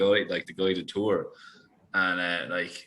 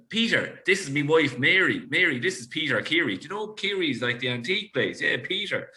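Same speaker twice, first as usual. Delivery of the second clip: higher-pitched, hammed-up introduction; imitated Waterford acquaintance; 7 semitones higher than usual. Man introducing his wife to an acquaintance, imitated in a higher voice. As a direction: imitate an eager acquaintance in a raised voice. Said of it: "And then he's just".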